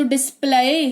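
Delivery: डिस्प्ले said wrongly as "dis-plaay", Y sounded; डिस्प्ले with an "eye" ending instead of "ay"; mispronounced with Y. Shown as a demonstration. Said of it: "वाई जिसका उच्चारण य होता है".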